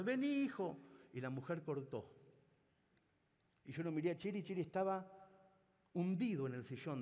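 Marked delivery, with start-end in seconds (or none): 0.0-0.8 very high, eager imitating mother; overjoyed mother. Mother promising to cook for her son, very high delighted voice. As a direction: agree at once, delighted.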